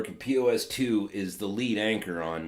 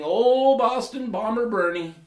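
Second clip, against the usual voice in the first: mock announcer; 12 semitones higher, 8 dB louder than usual. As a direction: boom out the nickname like a ring announcer. Slogan delivered as a jingle.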